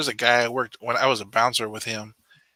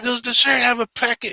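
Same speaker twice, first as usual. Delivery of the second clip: angry customer; 13 semitones higher, 4 dB louder than usual. Retold or imitated. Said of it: "He's like".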